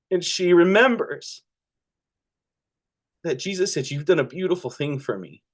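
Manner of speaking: fighting back tears as you tell it; emotional. Real, not acted. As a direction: voice close to breaking, held back. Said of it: "Sorry, I'm trying not to get emotional".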